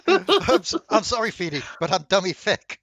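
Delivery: high, loud comic apology; hammed-up squeaky apology, pushed loud; apologetic character voice. GM voices Jeffrey apologising to Feeny.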